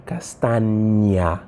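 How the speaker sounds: Castaña very slowly, with an NY sound; Spanish ñ. Shown as a demonstration. Teacher shows students the letter, Castaña slowly with NY. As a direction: draw out Castaña to show the Ñ as NY. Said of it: "she pronounced the letter N".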